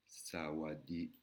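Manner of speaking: slow "Sawadi" with a rising end; careful slow Thai model word; Thai greeting. Shown as a demonstration. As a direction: draw out "Sawadi" slowly, tone lifting. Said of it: "un mot très basique".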